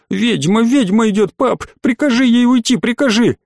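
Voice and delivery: put-on a scared kid clinging and pleading; panicked child. A narrator's reading. Boy clinging to his dad as the inspector approaches, panicked. Frightened child begs father.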